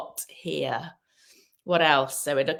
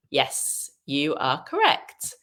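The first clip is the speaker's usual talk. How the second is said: ordinary talk, nothing marked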